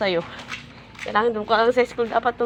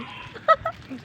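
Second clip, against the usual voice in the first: breathy laugh. Real, not acted. Mother laughs at child.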